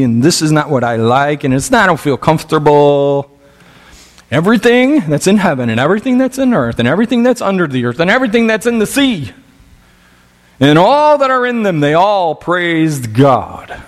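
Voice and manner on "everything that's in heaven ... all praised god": quick, high-pitched preacher's list; building proclamation. Real, not acted. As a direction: pile up each phrase, higher and faster, then proclaim.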